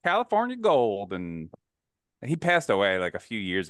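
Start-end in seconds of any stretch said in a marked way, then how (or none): 0.0-1.1 upbeat TV host voice, pitched higher; Huell Hauser impression. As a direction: gush like a cheery California TV tour guide. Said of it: "he was this guy from California".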